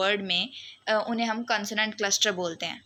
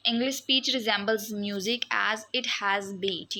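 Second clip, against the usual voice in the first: slow dictation read; much slower than usual. Acted. Slow reading of textbook quote.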